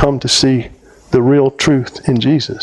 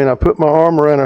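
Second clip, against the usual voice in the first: normal talking voice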